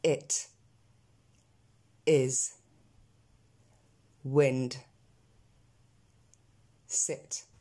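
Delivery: "It", "is", "wind", "sit" with a French è-like vowel; spaced-out drill, "sit" close to set; corrected vowel. Shown as a demonstration. Teacher having learners repeat the E sound, corrected pronunciation. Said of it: "on les répète ensemble".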